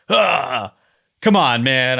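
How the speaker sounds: groaning rebuke to a buddy; upset scolding. Retold or imitated. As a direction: scold a littering friend, fed up. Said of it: "my L and T-ness got all upset".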